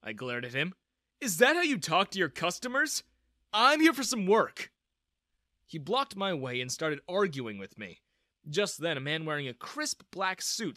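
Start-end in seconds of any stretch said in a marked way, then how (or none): 1.2-4.7 higher, louder indignant protest. Acted. Girl snaps back at the blocking guard, indignant. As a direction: stand up to him, offended.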